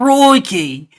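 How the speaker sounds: high, shouted mock Australian "Crikey!". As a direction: belt it like a cartoon Aussie. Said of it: "What if it's Australian?".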